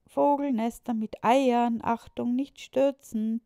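put-on lively child reading a sign. A narrator's reading. Children's sign read out, high and loud. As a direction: announce the warning proudly like a child.

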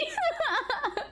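sudden high squeal of a laugh. Real, not acted. Laughing at a teasing chat comment. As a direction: let a high laugh burst out.

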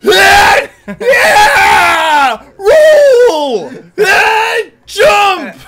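high, loud triumphant yell; heroic shout. Shown as a demonstration. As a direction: belt it like a hero. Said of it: "I'll be sitting there".

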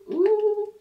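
high, loud squeal of delight; delighted ooh. Real, not acted. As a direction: squeal with surprise. Reacts to the next item.

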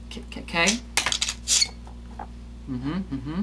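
ordinary talk, nothing marked

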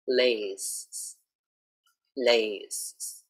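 "lays" with a crisp L and a z at the end; clear L, final S. Shown as a demonstration. Teacher stressing the L and final S of "lays". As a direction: drill "lays" twice, carefully. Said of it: "get the L sound clearly and to also have the S on the end".

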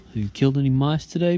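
playful high question to a pet; pet talk. Real, not acted. Teasing the cat.

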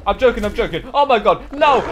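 loud, high yelps rising to a shout; playful panic. Pretend. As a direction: frantically take it back as the attacker comes. Panics as he is attacked.

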